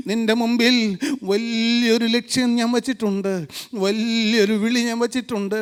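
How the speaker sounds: low, soft, intimate sermon voice; hushed preaching. Real, not acted. Preacher voicing Jesus telling Mary of her calling, hushed preaching. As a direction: drop down low and quiet, as if confiding.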